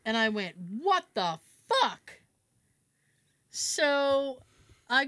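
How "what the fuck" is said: slow, drawn-out disbelief; dumbfounded, dragged out word by word; retold stunned reaction. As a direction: stretch each word out in retold shock. Retold shock at broken faucet.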